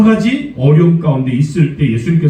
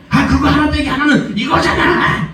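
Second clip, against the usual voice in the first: impatient parent; 13 semitones higher than usual. Retold or imitated. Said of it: "말하잖아요".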